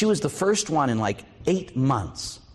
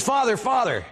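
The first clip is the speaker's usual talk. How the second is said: put-on kid calling out; 11 semitones higher than usual. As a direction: call him back before he leaves. Kid calls after priest.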